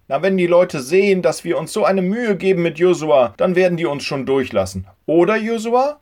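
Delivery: hopeful father. A narrator's reading. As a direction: encourage your friend on the stretcher. Voices father.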